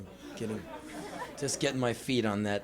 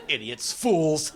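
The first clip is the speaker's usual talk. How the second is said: slow, mocking put-down between laughs; sneering scorn; 3 semitones higher, slower than usual. Terrified boy against the wall jeering at dancers, scornful. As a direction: act superior with a forced scoff.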